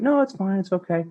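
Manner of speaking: high mock brush-off, no, it's fine; mock high wife voice. Husband mimics wife brushing him off, high and mocking. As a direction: squeak it out like a dismissive wife. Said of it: "You wanted me to be like".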